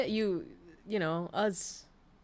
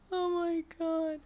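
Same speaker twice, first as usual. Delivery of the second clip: high, drawn-out oh my god; amazed; 10 semitones higher, slower than usual. Real, not acted. Streamer hearing about the stuffed-animal bed, slow high disbelief. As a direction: let it stretch out, half in disbelief.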